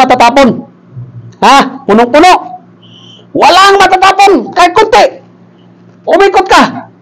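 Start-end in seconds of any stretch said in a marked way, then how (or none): 3.3-5.2 high, insistent command; emphatic order. Acted. Pastor telling member not to spill the water, emphatic order. 6.0-6.9 high-pitched, commanding call; emphatic order. Acted. Pastor ordering member to walk round church, emphatic order.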